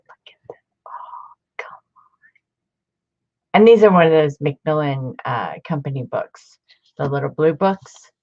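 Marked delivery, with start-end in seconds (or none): 0.1-3.5 a slow, breathless whisper of awe; awed whisper. Real, not acted. Showing the end paper to viewers, an awed slow whisper. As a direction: hush it out, amazed by the book.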